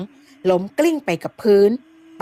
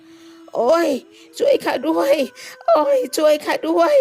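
groaning in pain; 8 semitones higher, slower than usual. Acted. Headman groans after fall.

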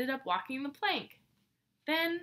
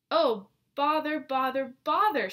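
loud, higher, cross repeated outburst; angry shout; 4 semitones higher, 7 dB louder than usual. Acted. Mouse finds her buttons done up wrongly, shouting angrily. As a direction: stamp out each bother in a temper. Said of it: "she shouted angrily".

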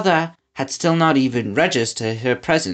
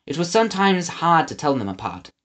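ordinary delivery

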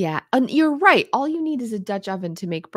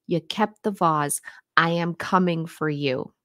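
ordinary delivery

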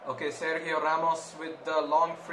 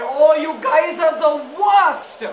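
slow, loud, much higher complaint; exasperated rant; 8 semitones higher, 10 dB louder, slower than usual. Real, not acted. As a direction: vent at the team in disgust. Another offside call.

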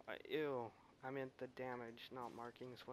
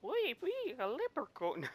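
much higher and louder, hammed-up Irish leprechaun accent; terrible leprechaun accent; 13 semitones higher, 8 dB louder than usual. Joking about his bearded game character, hammed-up Irish leprechaun accent. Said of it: "That was a terrible accent".

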